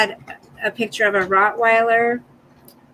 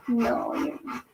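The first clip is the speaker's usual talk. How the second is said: soft whispered no; whispered reassurance; 10 dB quieter than usual. Real, not acted. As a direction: whisper it gently to calm the dog. Reassuring dog softly.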